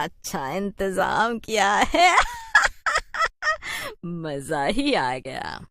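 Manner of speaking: slow, smug witch voice with a cackle. Acted. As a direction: boast slowly about the feast. Host witch boasts slowly. (धीमी घमंडी चुड़ैल की आवाज़)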